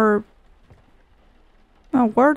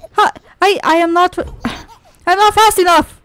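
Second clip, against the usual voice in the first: frantic exasperation; 6 semitones higher than usual. Real, not acted. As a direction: cry out in frustration, pitch raised. Player failing a timed chase.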